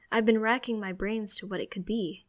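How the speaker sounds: ordinary delivery, nothing marked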